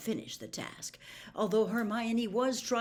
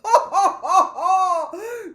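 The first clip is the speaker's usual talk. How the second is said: loud high ha-ha-ha; roaring laughter; 11 semitones higher, 15 dB louder, slower than usual. Acted. Slughorn amused by Harry's cheek, loud roaring laugh. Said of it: "roared with laughter".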